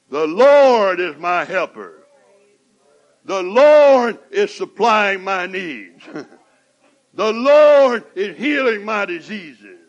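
loud, slow preaching declaration; fervent proclamation. Acted. Preacher to congregation, loud fervent declaration. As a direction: proclaim it at full force.

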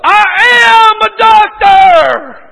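loud, high, drawn-out proclamation; arrogant doctor. Retold or imitated. Arrogant doctor answering the cleaners, pompous and loud. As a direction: boom it out slowly with huge self-importance. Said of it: "He was so arrogant".

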